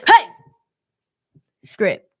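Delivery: short, loud threatening yell; threatening shout. Retold or imitated. Attacker startles a girl in his bedroom, threatening shout. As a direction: bark it like an attacker's order. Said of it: "He was threatening her".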